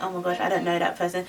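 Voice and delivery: quick, low, soft disowning; embarrassed aside. Retold or imitated. Vlogger disowning friend as people stare, embarrassed quiet aside. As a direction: mumble it fast under the breath.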